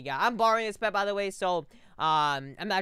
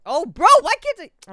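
high, loud frustrated squeal at himself; tripping over his own words, annoyed; exasperated outburst; 12 semitones higher, 15 dB louder than usual. Real, not acted. Annoyed at himself for fumbling a price, high squeal. Stumbling over saying a number.

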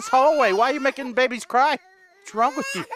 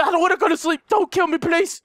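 ordinary delivery

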